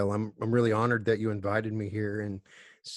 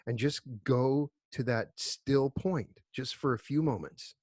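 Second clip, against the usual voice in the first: guided meditation. Acted. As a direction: guide them unhurriedly into stillness, letting phrases settle. Leads a group meditation.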